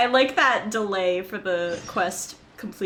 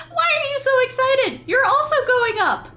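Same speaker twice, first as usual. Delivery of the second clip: high, loud excited sidekick voice; squeaky companion, thrilled and alarmed; Paimon voice; 13 semitones higher, 8 dB louder than usual. Acted. Voices Paimon reading game lines.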